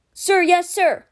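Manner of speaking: crisp soldier's response, loud and high; high-pitched, forceful military acknowledgement; militaristic reply. A narrator's reading. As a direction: answer like a squad saluting its captain. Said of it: "always somewhat militaristic".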